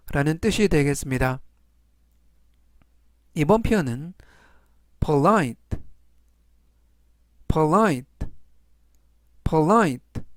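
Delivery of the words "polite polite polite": "polite" said three times, puh-LITE, stress on the second syllable; pronunciation drill. Shown as a demonstration. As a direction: model "polite" carefully, word by word. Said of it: "라고 발음하시면 되겠고요". ("polite"를 세 번 천천히 발음 시범)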